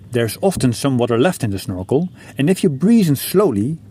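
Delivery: ordinary talk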